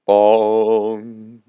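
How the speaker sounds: long hollow bong; imitated bell toll. Storyteller voicing the bell tolling in the sky, drawn-out bong. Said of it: "said the bell".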